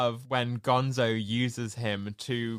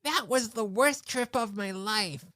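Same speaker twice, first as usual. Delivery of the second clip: Rizzo impression; 7 semitones higher than usual. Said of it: "Rizzo goes".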